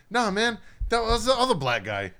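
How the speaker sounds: high, loud retold protest; imitating an emphatic denial; loud mock correction. Retold correction of mistaken identity.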